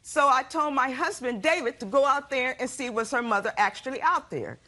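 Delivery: usual speaking voice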